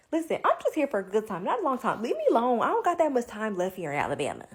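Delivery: loud, sassy blow-off; mock loud brush-off. To a pushy guy, loud sassy brush-off. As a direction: shout it off with attitude.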